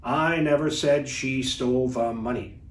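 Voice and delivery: as monotonous as possible, every word level; monotone. Shown as a demonstration. Coach giving the sentence before adding emphasis, monotone. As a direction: drone it out on one note. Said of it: "in as monotonous a way as I can".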